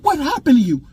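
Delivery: put-on high-pitched, heated demand to a child; furious questioning. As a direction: retell an angry dad urgently pressing his son for answers. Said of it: "I was furious".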